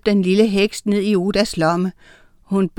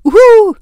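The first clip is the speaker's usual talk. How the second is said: loud, high owl call; high, sustained "uhu"; owl hoot; 13 semitones higher, 11 dB louder than usual. A narrator's reading. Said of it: "tudede Uline".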